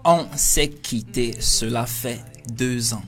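careful, slowed read of the line; slow, clear French demo. Shown as a demonstration. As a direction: read it out slowly for the learner. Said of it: "你把这句法语那个读一遍".

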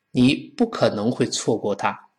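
usual speaking voice

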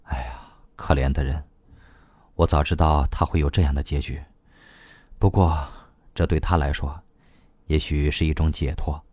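low, soft, resigned pity; softly spoken. A narrator's reading. Man reflects on an acquaintance's death, softly. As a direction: sigh it out gently, pitched well down. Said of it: "睁开眼睛轻声说".

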